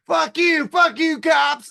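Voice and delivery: high, loud yell at the cops; methed-out yelling. Retold or imitated. A methed-out guy screams at the cops on a reality show, loud and high. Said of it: "get methed out and goes".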